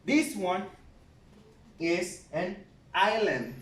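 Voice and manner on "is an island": island said as EYE-lund with no s sound; silent s in island. Shown as a demonstration. Teacher shows the class island without the s. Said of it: "You don't pronounce the S".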